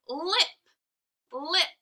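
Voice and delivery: "lip" set apart, ending in a puff; final P sound. Shown as a demonstration. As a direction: say "lip" alone and pop the last "p". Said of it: "we hear the p at the end of lip".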